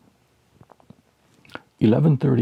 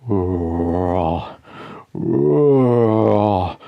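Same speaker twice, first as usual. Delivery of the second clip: mummy moan. A narrator's reading. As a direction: groan it like a dried-up mummy. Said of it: "The mummy says".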